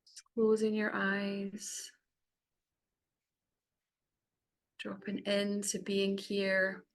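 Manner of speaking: unhurried meditation voice with long pauses; guided meditation. Acted. Facilitator guiding the group to close their eyes, guided meditation. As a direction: ease them gently inward, phrase by phrase. Leads a cacao ceremony meditation.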